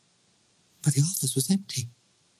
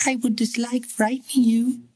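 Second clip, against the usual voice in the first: put-on higher, sweet little-girl lilt; high musical child voice; 9 semitones higher than usual. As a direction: soothe him like an uncanny child. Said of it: "said the high, musical voice".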